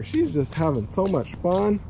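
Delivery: ordinary talk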